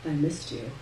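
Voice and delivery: soft, breathy, close to the ear; breathed in ear. A narrator's reading. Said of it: "He breathed in my ear".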